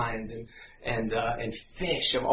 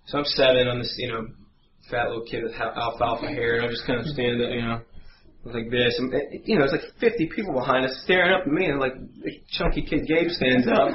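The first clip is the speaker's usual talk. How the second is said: ordinary talk, nothing marked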